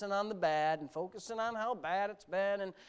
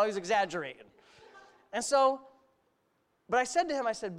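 usual speaking voice

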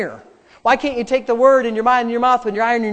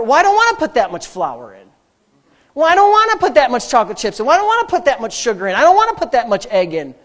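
whiny objector; 3 semitones higher, 4 dB louder than usual. Acted. Refusing grandma's measurements, whiny objection. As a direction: complain your way through each ingredient. Mimics a reluctant recipe user.

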